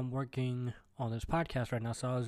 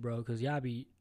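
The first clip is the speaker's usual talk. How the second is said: usual speaking voice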